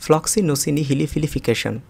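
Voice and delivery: ফ্লক্সিনোসিনি হিলিফিলিফিকেশন run together quickly in one go. Shown as a demonstration. Teacher showing the quick version of ফ্লক্সিনোসিনি হিলিফিলিফিকেশন. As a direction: rattle off ফ্লক্সিনোসিনি হিলিফিলিফিকেশন briskly. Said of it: "আমি আবার উচ্চারণ করছি".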